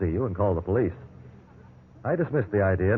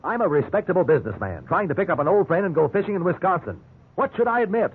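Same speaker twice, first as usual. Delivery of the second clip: much higher, exasperated protest; outraged disbelief; 9 semitones higher than usual. Acted. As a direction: cry it out in disbelief. Suspect outraged at accusation.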